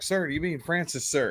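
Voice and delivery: high, quiet, unsure recruit asking sir; a hint of a nervous recruit addressing the drill instructor; timid polite question. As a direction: ask it meekly, like a nervous recruit. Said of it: "finally I'm like".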